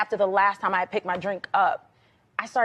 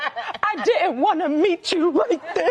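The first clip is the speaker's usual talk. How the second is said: laughing embarrassed quote; 7 semitones higher, slower than usual. Retold or imitated.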